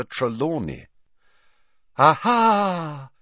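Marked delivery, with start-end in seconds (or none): 2.0-3.1 high theatrical exclamation; dramatic cry. Acted. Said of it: "she cried".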